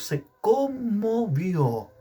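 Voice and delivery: "conmovió" stretched syllable by syllable; slow, lingering stress on "conmovió"; drawn-out emphasis. Real, not acted. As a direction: dwell on each syllable of "conmovió". Preacher stretches the key verb.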